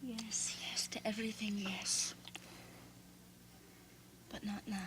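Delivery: breathy whispered yes, soft and close; whispering. Acted. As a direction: whisper it like a secret promise. Intimate whispered yes to lover.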